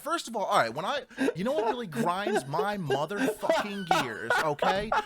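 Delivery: high, loud comic exasperation; riled rant. Real, not acted. Ranting to friend who teased his accent, loud exasperation. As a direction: blow up in half-laughing indignation.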